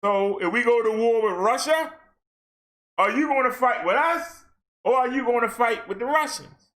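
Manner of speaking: ordinary talk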